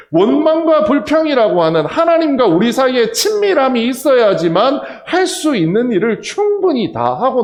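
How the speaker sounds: loud, high pulpit preaching; full-force preaching. Acted. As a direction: drive it home to the congregation.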